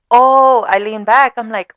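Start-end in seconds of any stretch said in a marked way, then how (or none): 0.1-0.6 higher, louder startled oh. Retold or imitated. When he leans in to kiss, a startled oh. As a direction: jolt back with a loud oh.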